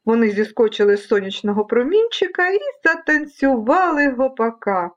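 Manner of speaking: ordinary delivery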